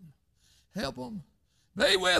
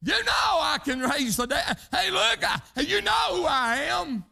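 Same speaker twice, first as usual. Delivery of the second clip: high, loud proclamation; preacher voicing Jesus with a big, high shout; 10 semitones higher, 3 dB louder than usual. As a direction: cry out as Jesus declaring who he is. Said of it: "He said".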